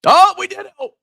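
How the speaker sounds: high squeal of joy; excited squeal. Real, not acted. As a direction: squeal it like your coin just mooned. Bitcoin crosses seventy K.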